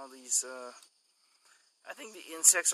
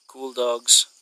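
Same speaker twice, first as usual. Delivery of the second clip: ordinary delivery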